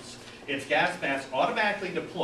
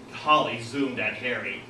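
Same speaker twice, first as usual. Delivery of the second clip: usual speaking voice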